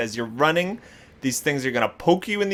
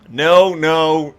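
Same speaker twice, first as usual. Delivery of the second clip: emphatic no; 6 dB louder than usual. Real, not acted. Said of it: "Emphatic no".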